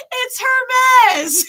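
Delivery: "Hermes" yelled high and loud; shouted correction. Retold or imitated. Audience member corrects the comedian, shouting. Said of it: "screamed at him".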